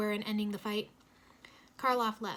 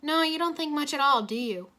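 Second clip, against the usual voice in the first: taunting; 5 semitones higher, 6 dB louder than usual. A narrator's reading. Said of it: "Kai taunted".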